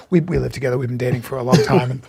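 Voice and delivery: low, soft imitated murmur; confiding. Jimmy telling the bartender behind her back, confiding. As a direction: confide it quietly as plain fact.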